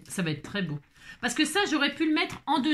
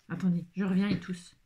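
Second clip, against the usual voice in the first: ordinary delivery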